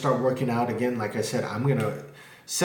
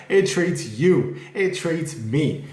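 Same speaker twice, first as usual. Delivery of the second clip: drawn-out stress on "you" and "me", pitched up; slow, raised, deliberate punch on "you" and "me"; emphatic contrast; 6 semitones higher, 4 dB louder, much slower than usual. Real, not acted. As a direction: hammer home "you", then "me", slowly. Driving home a contrast about fluoride.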